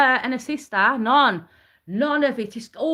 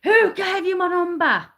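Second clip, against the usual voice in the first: imitating an irate phone rant, pitched up; mock outraged demand, higher and sharper; going ballistic; 5 semitones higher than usual. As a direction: act out him blowing up on the call. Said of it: "he starts going ballistic on the phone".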